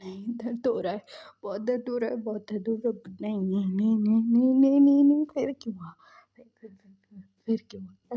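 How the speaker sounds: acted fear building to a loud cry, then stammering; retold panic swelling on repeated denials; mock terror that surges and collapses; frightened. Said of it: "डरते डरते ये सब बोल रहा था".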